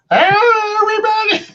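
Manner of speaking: high, stretched goofy "Hey, everybody!"; Jerry Lewis. Retold or imitated. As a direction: squeal it like a zany comic host. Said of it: "my Jerry Lewis impression".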